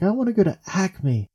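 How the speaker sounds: put-on a kid's keen request; eager kid answer. Child answering mom about the store, eager.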